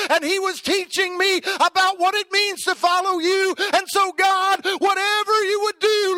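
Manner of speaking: put-on a boy crying out to God, begging; desperate boy's prayer. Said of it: "I wonder if Ishmael's over there saying".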